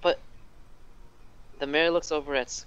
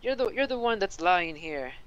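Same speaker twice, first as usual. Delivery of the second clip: higher, hot accusation thrown back; infuriated; 7 semitones higher than usual. Acted. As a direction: snap it back at the mayor, outraged. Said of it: "Skilk is infuriated".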